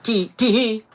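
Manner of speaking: high squeaky giggle Tee-hee; imitating a viewer's giggly tee-hee; girlish tee-hee. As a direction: giggle it like a cute girl in chat. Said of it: "She'd be like".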